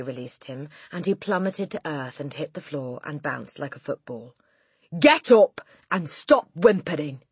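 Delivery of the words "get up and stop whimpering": loud, harsh command; barking Trunchbull. A narrator's reading. Headmistress to the boy on the floor, loud bark. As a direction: bark it out as an order. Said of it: "the Trunchbull barked".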